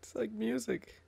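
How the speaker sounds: high, soft, slow squeak of amusement; amused squeal. Real, not acted. Teasing friend about the glitched voice, amused squeal. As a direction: squeeze it out high, nearly laughing.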